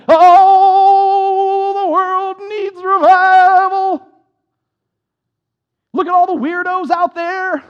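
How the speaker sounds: loud, high, long-drawn revival holler; eighties Southern Baptist preacher. Retold or imitated. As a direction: wail it out like a hammed-up revivalist, stretching the vowels. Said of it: "preach like Southern Baptists from the eighties, but they all do".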